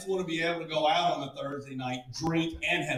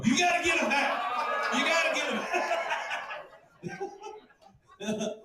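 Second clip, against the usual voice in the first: high-pitched comic yelling; mock outburst; 12 semitones higher than usual. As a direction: shout it way up high. Acted outburst at end of bit.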